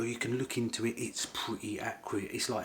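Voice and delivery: ordinary delivery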